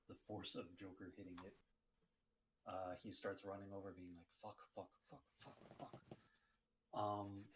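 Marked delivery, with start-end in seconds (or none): 4.4-6.0 soft, repeated panicky swearing under the breath; muttered cursing. Acted. Player voices character running over, muttered cursing. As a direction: curse quietly on the run.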